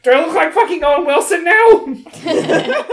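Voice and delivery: high, drawn-out hurt whine; pained complaint. Acted. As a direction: whine it slowly, high and aggrieved. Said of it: "and he's like".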